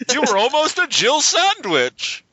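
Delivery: high, slow, hammy cutscene delivery; bad acting. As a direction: ham up the cheesy line, drawn out. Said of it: "this acting is terrible".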